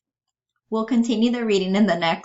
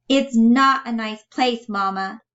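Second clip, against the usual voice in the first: higher, louder, pointed rebuke; raised-pitch emphatic accusation; 5 semitones higher, 6 dB louder than usual. Acted. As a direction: aim it firmly at Mama. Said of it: "She looks at Grandma pointedly".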